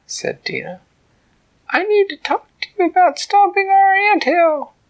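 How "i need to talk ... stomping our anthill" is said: high, loud little ant; tiny ant voice. A narrator's reading. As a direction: speak up boldly as the tiny ant.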